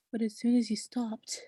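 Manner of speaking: ordinary talk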